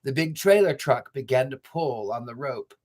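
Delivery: usual speaking voice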